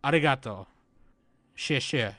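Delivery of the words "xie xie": normal talking voice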